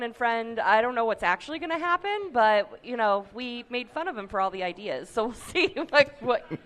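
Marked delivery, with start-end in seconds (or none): none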